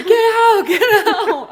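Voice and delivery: loud, high imitated shouts; retold yelling. Crowd ordering her out of the water, imitated yelling. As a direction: shout the warning like a frantic crowd. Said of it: "just people yelling".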